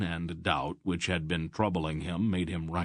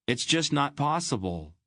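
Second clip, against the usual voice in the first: put-on higher boy's protest; boy's disbelief; 8 semitones higher than usual. Garion protesting to Wolf, disbelieving. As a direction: protest that it can't be true.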